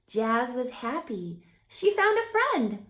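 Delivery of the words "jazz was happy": plain reading voice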